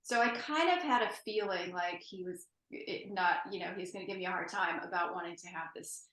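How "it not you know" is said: normal talking voice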